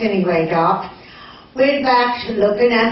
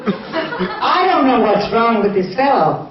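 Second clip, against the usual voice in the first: ordinary talk, nothing marked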